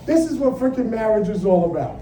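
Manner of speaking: usual speaking voice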